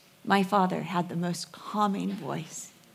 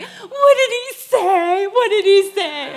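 much higher, louder, laughing questions; amused guests; 12 semitones higher, 9 dB louder than usual. Retold or imitated. Party guests laughing, high and loud asking what he said. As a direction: squeal the question through laughter. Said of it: "everyone's like".

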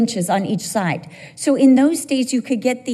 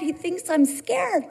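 usual speaking voice

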